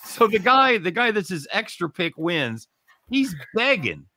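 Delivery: high-pitched, worked-up complaint; indignant protest. Real, not acted. Host complains about the extra pick, high and worked up. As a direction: argue it high and exasperated.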